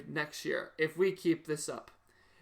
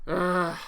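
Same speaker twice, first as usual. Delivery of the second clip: weary groan; 3 dB louder than usual. Real, not acted. Second groan.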